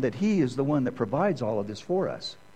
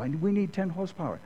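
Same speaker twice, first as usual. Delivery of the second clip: imitated Japanese manager; 7 semitones higher than usual. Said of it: "He said".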